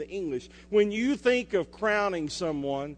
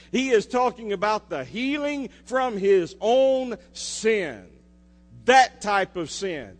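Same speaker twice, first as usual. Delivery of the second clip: raised, driving sermon voice; fervent preaching; 4 semitones higher, 6 dB louder than usual. Acted. Pastor preaching on healing to the church, fervent and raised. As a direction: preach it out to the congregation.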